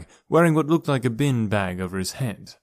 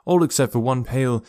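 plain reading voice

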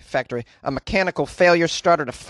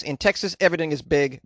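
ordinary talk, nothing marked

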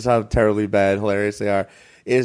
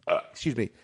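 belching between words; burping from seltzer; 8 dB quieter than usual. Real, not acted. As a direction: talk through a seltzer burp. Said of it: "I'm drinking seltzer".

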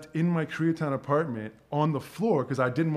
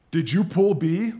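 stern doctor; 4 semitones higher, 6 dB louder, slower than usual. Retold or imitated. Doctor confronts him mid-surgery.

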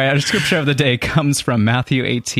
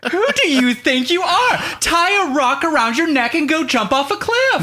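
very high, hammed-up outrage; indignant Jesus; slower than usual. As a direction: rant in mock fury, pitch soaring. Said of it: "Jesus was like".

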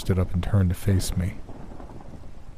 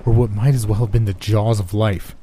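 plain reading voice

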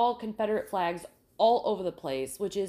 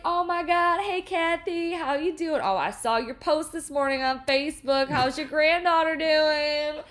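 higher, chatty mock gush; gushing diner regular; 7 semitones higher, 5 dB louder than usual. Diner regulars catching up, gushing mock voice. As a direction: gush the small talk like a local gossip. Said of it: "It was like".

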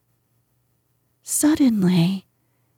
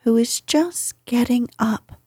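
plain reading voice

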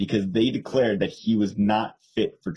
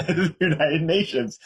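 stretched out slowly through a laugh, pitched higher; laughing emphasis; 6 semitones higher, slower than usual. Real, not acted. To her co-host about the worst government, higher, slow and laughing. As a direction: savour the punchline, laughing at how absurd it is.

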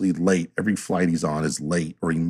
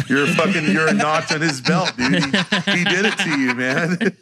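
higher, laughing through the jab; laughing tease; 8 semitones higher than usual. Retold or imitated. Mocking his worn-out buddy, laughing. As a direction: crack up while calling him a victim.

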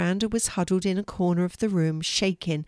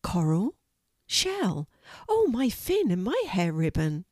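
brighter voice. A narrator's reading. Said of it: "her voice became brighter".

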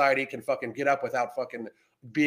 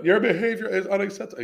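mock prim scold; 7 semitones higher, faster than usual. Mocking a prim scolding quote.

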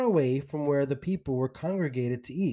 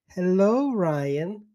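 fake smile; 5 semitones higher, 3 dB louder, much slower than usual. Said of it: "she said with a fake smile".

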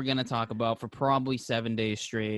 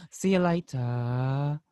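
very slow, drawn-out goodbye; bored yet excited; much slower than usual. Retold or imitated. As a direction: sound bored and excited at once. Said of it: "bored, but also excited".

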